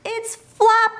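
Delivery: high, delighted cry of discovery; children finding their lost bunny, overjoyed; joyful cry. A narrator's reading. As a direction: burst out with glee. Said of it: "they cried out".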